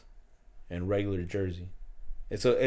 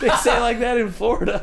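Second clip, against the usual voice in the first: high, loud, cracking up while talking; real laughter bursting through the words; laughing; 10 dB louder than usual. Real, not acted. Laughing at the pronunciation.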